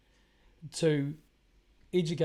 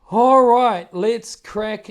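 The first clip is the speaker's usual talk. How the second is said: big loud "All right" to restart; loud kickoff; 6 semitones higher, 17 dB louder, much slower than usual. Real, not acted. Host relaunching the show to his viewers, loud. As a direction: announce it like a host.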